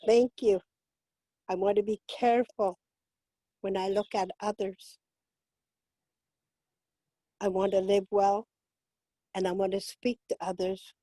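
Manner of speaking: spoken blessing. Acted. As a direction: read the prayer gently, as a gift. Reads blessing in English.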